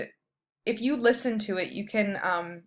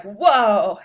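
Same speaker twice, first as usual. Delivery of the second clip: mock amazement; 9 dB louder than usual. Describing the aha moment.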